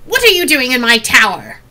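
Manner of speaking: put-on loud, accusing old crone; loud crone challenging an intruder; old woman's croak. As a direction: demand it as a cranky old woman. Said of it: "hear an old woman's voice".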